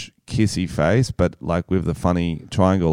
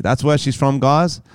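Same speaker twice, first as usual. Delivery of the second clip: normal talking voice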